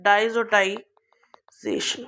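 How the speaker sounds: slow whispered syllable-by-syllable diazotization; teacher drilling the term diazotization in a slow whisper; spelled out slowly. Shown as a demonstration. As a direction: breathe out diazotization one syllable at a time, very slowly. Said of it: "This process का नाम है".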